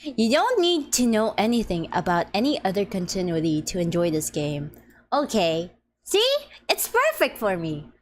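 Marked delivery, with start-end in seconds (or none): 6.0-6.3 excited. Real, not acted. Excited remark.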